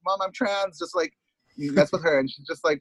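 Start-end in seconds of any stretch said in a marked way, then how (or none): 0.0-0.8 teasing. Retold or imitated. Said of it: "So I always tease her".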